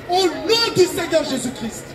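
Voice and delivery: ordinary talk, nothing marked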